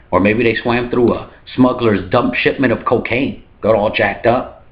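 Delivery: ordinary delivery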